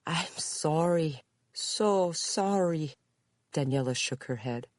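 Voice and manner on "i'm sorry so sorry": put-on very slow, higher Spanish-accented apology. A narrator's reading. Visitor apologises to Judy, slowly, Spanish accent. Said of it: "answered the woman with a Spanish accent".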